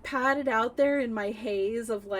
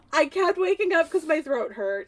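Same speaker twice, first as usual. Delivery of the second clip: ordinary delivery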